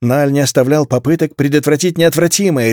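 ordinary delivery

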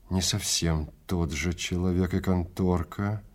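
usual speaking voice

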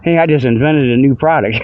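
normal talking voice

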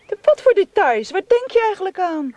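high, indignant demand. Acted. Marion, stung by his insinuation, demands answers indignantly. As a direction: fire back, stung and offended.

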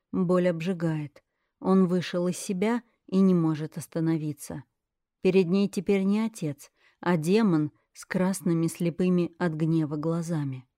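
plain reading voice

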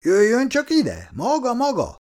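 high-pitched, insistent police summons; officious call, pitched well up. A narrator's reading. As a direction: order someone over from afar. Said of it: "kiabált a rendőr".

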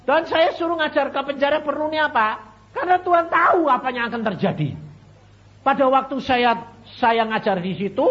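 loud, high sermon delivery; ringing, emphatic preacher; full-force preaching. Real, not acted.

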